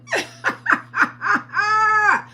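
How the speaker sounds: 叫ぶような高笑い; shrieking laugh. Real, not acted. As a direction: howl with loud high laughter. Teasing viewer about husband.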